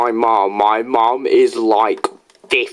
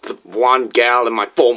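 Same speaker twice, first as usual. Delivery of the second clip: usual speaking voice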